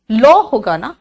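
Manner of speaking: usual speaking voice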